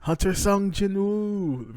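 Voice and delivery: acted panicked yell for the fainted hunter; screaming. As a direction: shout his name across the room in alarm. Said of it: "We are just screaming".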